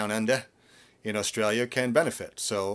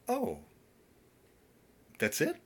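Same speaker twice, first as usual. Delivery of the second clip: surprised student; 8 semitones higher than usual. Retold or imitated. Students surprised by easy result.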